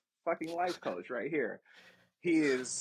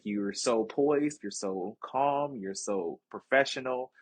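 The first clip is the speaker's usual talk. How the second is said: slow admiring; slower than usual. Retold or imitated. Retold recruiter praise.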